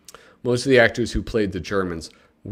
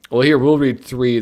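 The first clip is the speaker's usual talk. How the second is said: normal talking voice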